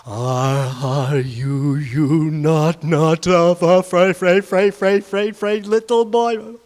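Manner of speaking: loud, stuttered creepy taunt; stuttering monster taunt. Acted. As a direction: stammer the words menacingly. Glowing face taunts boy.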